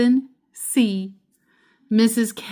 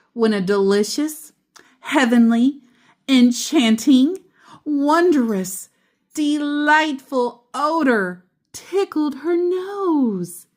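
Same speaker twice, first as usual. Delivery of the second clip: each word climbing higher and louder, then slowing; building delight; 6 semitones higher, 3 dB louder than usual. A narrator's reading. Reader to children, growing thrilled over the smells. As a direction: savor every lovely word, rising with delight.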